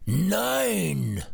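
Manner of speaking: high, furious lion roar; angry roar. A narrator's reading. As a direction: roar it out in rage. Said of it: "brüllte der Löwe zornig zurück".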